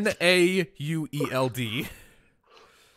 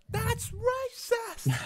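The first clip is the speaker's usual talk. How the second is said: put-on high, slow kid's squeal into the camera; little kid screaming; 4 dB quieter, much slower than usual. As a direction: squeal like a tiny outraged kid. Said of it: "cute little kid screaming into the camera".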